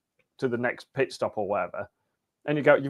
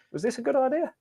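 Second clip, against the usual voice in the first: doubtful; 13 semitones higher than usual. Retold or imitated. Looking back at contract.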